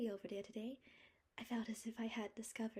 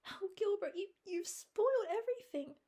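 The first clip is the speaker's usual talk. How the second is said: much higher, louder anguished stammer; miserable outcry; 14 semitones higher, 9 dB louder than usual. A narrator's reading. Anne telling Gilbert he has spoiled everything, anguished. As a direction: cry out that all is ruined. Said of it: "said Anne miserably".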